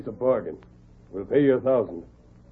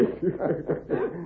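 gloating laugh; 6 semitones higher, 8 dB quieter than usual. Acted. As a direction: sly chuckle at the swindle. Crooks gloat over the deal.

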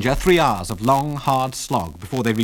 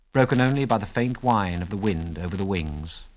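ordinary delivery, nothing marked

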